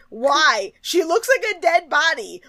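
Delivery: high-pitched disbelief; incredulous. Real, not acted. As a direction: protest it, baffled by their taste. Incredulous reaction to the judges.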